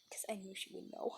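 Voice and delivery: hushed frightened whisper; frightened storyteller whispering; scared whisper. Real, not acted. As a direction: breathe it out barely voiced, scared. Scared narration drops to whisper.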